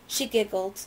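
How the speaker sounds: ordinary delivery, nothing marked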